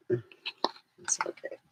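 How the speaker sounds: soft reassuring whisper; breathy whisper to calm the dog. Real, not acted. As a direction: hush it gently.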